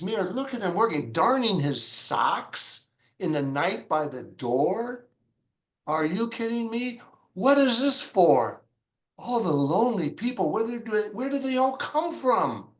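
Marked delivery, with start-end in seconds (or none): 5.9-12.7 Are you kidding me, said high and amazed; amazed disbelief. Real, not acted. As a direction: pitch jumps up in stunned wonder. Stunned by the song's lyrics.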